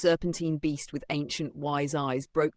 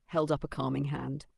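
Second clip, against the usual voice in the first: ordinary delivery, nothing marked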